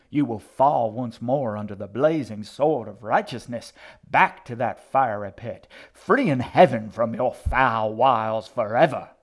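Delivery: very loud, building preacher's tirade; thundering righteous curse; fire-and-brimstone damnation. A narrator's reading. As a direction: cast out a devil with full force. Old woman damns the boy.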